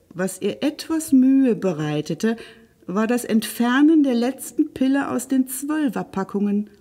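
plain reading voice

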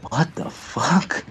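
slow, high, disbelieving; incredulous. Retold or imitated. Reacting to a strange meeting story, slow and disbelieving. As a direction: stretch it out in disbelief. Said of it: "It's like".

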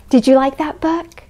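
ordinary delivery, nothing marked

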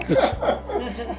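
real amused laugh after the punchline. Real, not acted. Teller laughing at his friend's embarrassment, real laugh. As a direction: let a short laugh escape at the memory.

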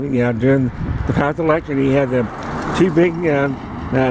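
normal talking voice

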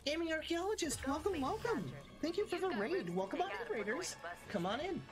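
cute anime streamer. Real, not acted. Streamer greeting raiders excitedly.

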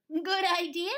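high, loud goat approval; Nanny Goat. Acted. Goat agrees with the farmer, high loud voice. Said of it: "said Nanny Goat".

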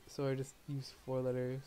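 usual speaking voice

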